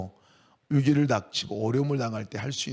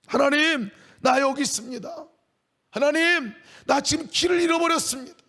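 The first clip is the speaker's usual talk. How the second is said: crying out to God; 11 semitones higher than usual. Acted. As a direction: call out to God like a lost sheep. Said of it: "외치고 소리치라는 얘기예요". (하나님을 부르짖는 높고 큰 외침)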